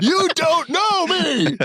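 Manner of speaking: drawn-out, high-pitched pretend outburst; over-the-top high, stretched protest; mock tantrum. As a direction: wail it slowly like a hammed-up diva meltdown. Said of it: "lose your temper one day".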